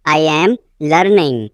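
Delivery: teacher separating off "learning"; broken-up repeat. Shown as a demonstration. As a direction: pause before "learning".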